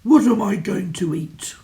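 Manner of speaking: loud troll complaining; grumpy troll. Acted. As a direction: moan it like a hungry troll. Troll voice continues.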